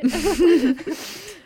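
high, loud burst of laughter. Real, not acted. Cracking up at own movie quote, loud high laugh.

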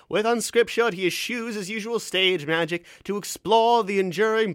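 big performed reading; hammed-up review read. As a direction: declaim it like a grand stage announcer. Reading a show review aloud.

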